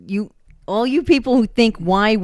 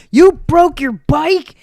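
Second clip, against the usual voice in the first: loud, high, drawn-out incredulous question; mock parental outrage; 7 semitones higher, 8 dB louder, much slower than usual. Parent caring only about the bike, loud outraged question. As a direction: imitate a parent appalled, rising at the end.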